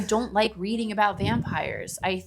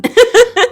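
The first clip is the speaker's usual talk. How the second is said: loud high laugh breaking out; cracking up at her friend's reply; burst of laughter; 15 dB louder than usual. Real, not acted. Laughing hard at the co-host's joke, loud burst.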